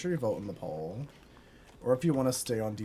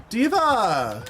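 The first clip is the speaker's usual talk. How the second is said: high, loud cry of the name; startled shout; 10 semitones higher, 10 dB louder than usual. Real, not acted. Gamer reacting to a sudden surprise, high loud shout. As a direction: yell the name in shock.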